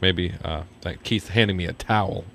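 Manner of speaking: usual speaking voice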